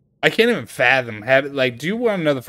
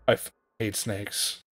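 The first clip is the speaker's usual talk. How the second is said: hushed, understated father's declaration; low-volume retold grumble; imitating father; 3 semitones lower, 11 dB quieter than usual. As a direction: say it very softly, as Dad. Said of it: "he said".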